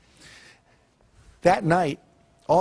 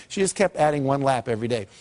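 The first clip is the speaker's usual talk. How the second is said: ordinary talk, nothing marked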